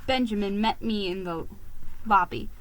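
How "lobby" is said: ordinary delivery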